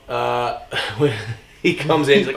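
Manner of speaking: ordinary delivery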